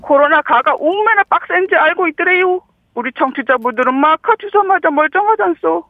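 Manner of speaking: high, lilting broad Gangwon speech; Gangwon dialect demo. Shown as a demonstration. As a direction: repeat the Gangwon sentence for a learner. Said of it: "강원도 사투리로 한번 해볼게요".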